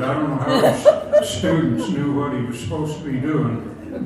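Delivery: normal talking voice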